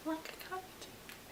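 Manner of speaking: slow, breathy whisper of the punchline. Shown as a demonstration. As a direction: whisper it slowly to tease. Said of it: "repeated in a different way".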